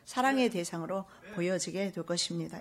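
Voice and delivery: ordinary talk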